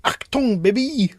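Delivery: a comic German director calling action; mock German accent. Shown as a demonstration. Co-host demonstrating his action call, mock German accent. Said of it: "can I do it in a German accent".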